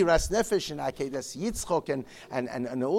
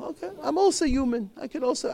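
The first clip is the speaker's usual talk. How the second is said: high mock plea; 12 semitones higher, 4 dB louder, faster than usual. Avram Avinu voiced in an imagined protest.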